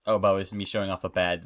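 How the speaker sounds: ordinary talk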